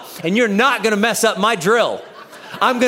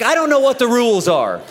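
ordinary delivery

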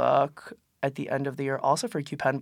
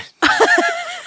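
high squeal laugh; 7 dB louder than usual. Real, not acted. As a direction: burst of high-pitched laughter. Laugh after the quote.

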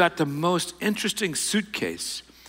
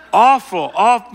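higher and louder, embarrassed groan of a word; dismayed; 7 semitones higher, 8 dB louder than usual. Real, not acted. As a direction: cringe at your own blunder. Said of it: "how awful".

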